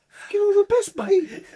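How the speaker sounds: very high, loud mocking squeal; incredulous mock repeat. Substitute mocking the send-off, squeaky and incredulous. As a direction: shoot it right up in outraged disbelief.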